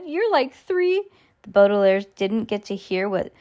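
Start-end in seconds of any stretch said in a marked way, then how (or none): 0.0-1.0 very high, slow giggler's voice; mocking amusement. A narrator's reading. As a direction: draw the jibe out in a squeaky tone. Nero mocking amid his giggles.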